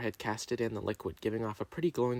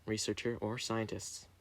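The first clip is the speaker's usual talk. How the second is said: ordinary delivery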